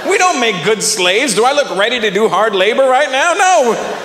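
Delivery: hammed-up indignant disbelief; mock outrage. Comedian protests being thought fit for labor, mock indignation.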